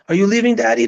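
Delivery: imitating a small child asking Daddy. Dad imitating his kid asking if he's leaving, high and childlike.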